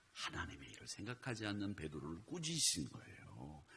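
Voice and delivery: normal talking voice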